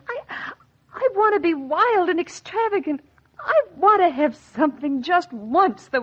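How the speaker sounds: high, pleading, the words spilling out; pleading outburst. Acted. Wife begs husband for the bracelet, high pleading cry. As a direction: let the longing burst out high.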